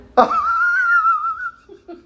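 shrill peal of laughter; high burst of laughter. Real, not acted. (carcajada aguda)

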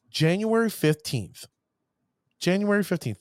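ordinary talk

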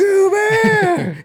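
high, loud, stretched-out mock yell; drawn-out shout. Co-host jumps in voicing the robot scene, drawn-out shout. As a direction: holler it comically, dragging it out. Interjects the character's imagined cry.

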